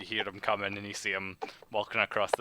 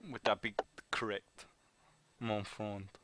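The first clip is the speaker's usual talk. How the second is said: put-on French accent, trailing softer; 8 dB quieter than usual. Informant confirming the traveller's attitude, French accent. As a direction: question politely in a French accent. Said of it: "your French accent".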